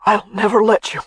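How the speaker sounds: dry crackling rasp. A narrator's reading. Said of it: "sounded like dried leaves being stomped on".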